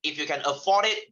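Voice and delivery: ordinary talk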